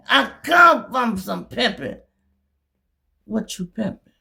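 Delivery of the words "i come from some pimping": louder, slower shout; proud shout. Real, not acted. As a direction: boast it loud and slow. Proud boast.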